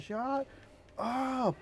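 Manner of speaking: put-on high, stretched southern-accented yell. Retelling guild mate silencing his bird, southern accent. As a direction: drag out both words in a southern accent. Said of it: "in, like, a southern accent".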